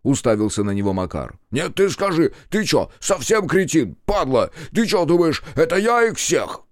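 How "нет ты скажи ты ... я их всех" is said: high, heated tirade; worked-up, high-pitched angry challenge; furious confrontation. A narrator's reading. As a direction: go at the accuser indignantly. Макар furiously confronts Степ.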